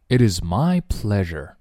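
ordinary talk, nothing marked

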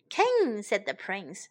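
ordinary delivery, nothing marked

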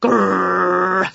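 long rolled growl; dog growl. A narrator's reading. Scruff the dog at Winnie up on the dresser, growling. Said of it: "said Scruff".

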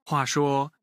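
ordinary delivery

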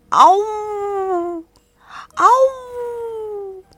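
high drawn-out playful howl; child's wolf howl. Acted. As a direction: howl like a little wolf, loud. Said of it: "像狼一样的大声嚎叫".

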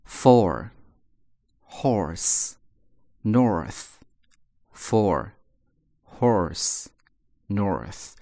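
for horse north with a curled r after the o; long o then a curled r in for, horse, north; r-colored or. Shown as a demonstration. Teacher models the American or sound. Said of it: "也卷舌头".

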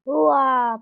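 loud wide-mouthed dinosaur roar "wa". Acted. Tyrannosaur roars at Liangtai.

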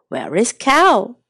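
usual speaking voice

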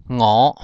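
我 as Hokkien "ngôo", starting on ng; initial nasal. Shown as a demonstration. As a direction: say 我 with an ng onset, rising. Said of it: "有个鼻音我".

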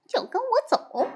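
high menacing summons; lightly voiced ghost order; spooky command. A narrator's reading. Fake envoy threatens.

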